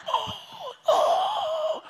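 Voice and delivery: high, strained gasping "Oh, oh" in pure shock; shocked quiet yell. Retold or imitated. Son opening the burnt eggs, shocked yell. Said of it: "the most shocked, quiet yell".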